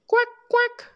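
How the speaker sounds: very high, loud quacks; duck quacking. Pretend. Duck sound for children.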